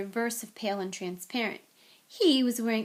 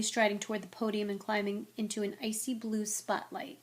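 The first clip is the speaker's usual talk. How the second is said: ordinary delivery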